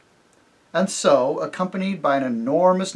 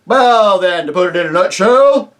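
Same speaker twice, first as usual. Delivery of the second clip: high, loud, hearty rustic voice with a chuckle; jovial rustic chief, laughing as he talks; chief of the invisible folk; 6 semitones higher, 11 dB louder than usual. Acted. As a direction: boom it out like a jolly simple village chief. Chief voice speaks.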